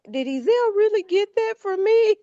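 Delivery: high-pitched mock wondering voice; imitating the boy. Relative imagining the boy's reaction to the gift, mimicked. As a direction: pitch it up to mimic him asking. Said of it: "He'll be like".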